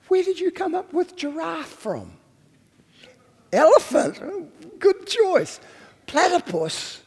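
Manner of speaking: high-pitched, playfully bemused voice; amused, surprised God. Acted. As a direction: act out an amused God reacting to odd animal names. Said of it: "really surprised and actually amused".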